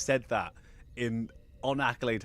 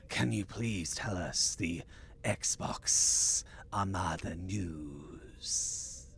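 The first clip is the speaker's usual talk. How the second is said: very slow, fading into a whisper; hammed-up hushed request; 3 semitones lower, 3 dB quieter, much slower than usual. Host asking co-host for the news, mock-dramatic whisper. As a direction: draw it out dramatically, down to a breathy whisper.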